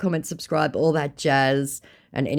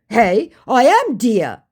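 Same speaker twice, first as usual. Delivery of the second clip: lightly mocked protest, slow and raised; scammer bluffing; 10 semitones higher, 8 dB louder, slower than usual. Scammer trying to pass as Aussie, mock insistence. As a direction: draw out a loud, high insistence. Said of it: "he tries".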